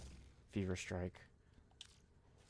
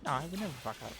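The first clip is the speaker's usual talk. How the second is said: mock confident; 7 semitones higher, faster than usual. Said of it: "And everyone's like".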